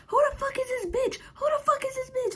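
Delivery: put-on high, crying scream of a furious girlfriend. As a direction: mimic her crying and screaming at once. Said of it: "She like".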